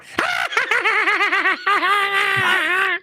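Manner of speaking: creature cackle shouted high. Retold or imitated. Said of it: "and he'll go".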